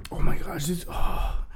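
breathless whisper of disbelief; hushed whisper. Real, not acted. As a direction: whisper it, stunned by the memory. Recalling the chaotic night.

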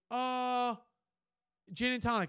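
lightly mocked drunk, high pitch; high, drawn-out dithering; drunk customer. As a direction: imitate a drunk hesitating over an order. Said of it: "He's fucking shit-faced".

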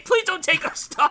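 high, desperate begging voice; pleading. Retold or imitated. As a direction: beg the bank for mercy.

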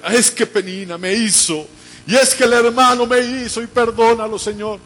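loud, high-pitched mock outcry; oración a gritos. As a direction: cry out the prayer dramatically for all to hear. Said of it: "no oraba en una forma fuerte". (oración fuerte y exagerada, a voz en grito)

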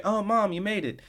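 high, sing-out mock delight; delighted greeting. Hitomi greeting Mom at the party, mock delighted. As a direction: imitate a daughter's high-pitched happy hello.

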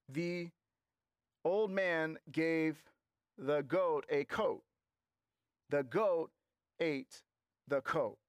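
halting, word-by-word beginner reader; child reading aloud. Acted. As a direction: sound out each word slowly like a young boy. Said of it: "His son reads it and he says".

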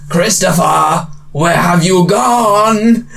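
slow, drawn-out eerie calling voice; Russian villain voice. Retold or imitated. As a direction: call out slowly like a game villain. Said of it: "It goes".

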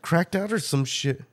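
ordinary talk, nothing marked

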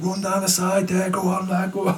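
high, calling encouragement; crowd cheering. Retold or imitated. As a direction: egg the runner on from the crowd.

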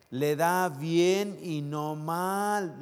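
drawn-out "bien" and "mal", each word held; very slow emphasis. Shown as a demonstration. Pastor savouring the verse for the church, very slow. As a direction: let every word land slowly. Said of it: "verse said twice".

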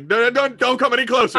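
high, loud, repeated plea to stop; panicked warning. Acted. Armed woman to approaching intruder, panicked high shout. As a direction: warn them off in alarm. Aims gun, warns intruder off.

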